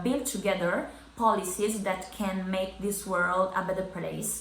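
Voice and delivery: normal talking voice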